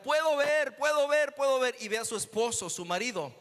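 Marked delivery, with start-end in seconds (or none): 0.0-1.7 higher and louder, crying out in amazement; joyful shout. Retold or imitated. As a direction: shout it like sight just came back. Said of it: "comienza a gritar". (grito de alegría, más agudo y fuerte)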